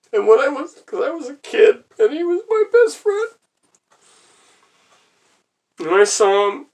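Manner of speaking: high, loud, choked-up cry; tearful breakdown. Real, not acted. Grieving a childhood best friend, tearful breakdown. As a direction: voice leaping up in grief, halting between phrases. Breaks down over dead cat.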